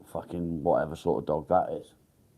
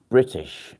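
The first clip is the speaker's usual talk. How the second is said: British said higher and louder, falling; stress on British; 6 semitones higher, 8 dB louder than usual. Shown as a demonstration. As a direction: hit the word British. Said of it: "You can hear a British accent".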